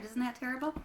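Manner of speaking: ordinary delivery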